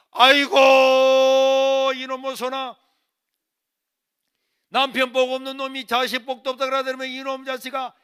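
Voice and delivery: loud high lament, stretched opening wail; wailing scolding mother. Retold or imitated. Mother banging the table at mealtime, loud wailing scolding. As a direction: rail at him in exasperation. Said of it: "아이고 하면서 막 숟가락을 두드려가지고".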